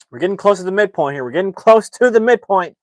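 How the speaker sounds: loud, high, hyped-up update; excited announcement. Real, not acted. Streamer catches up arriving viewers, loud excited announcement. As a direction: announce it to newcomers with big energy.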